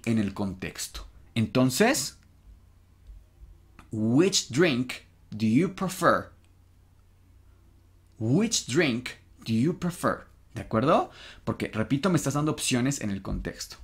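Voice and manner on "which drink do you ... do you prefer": slow English with a pause after "drink"; slow model reading. Shown as a demonstration. Teacher giving students the translated question, slow and careful. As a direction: model the question slowly for learners to copy.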